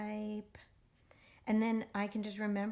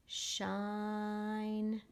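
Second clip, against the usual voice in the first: stretched word reading. Shown as a demonstration. Teacher modeling Shine for young readers, stretched out. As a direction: hold the vowel in Shine long and even. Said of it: "So you try it".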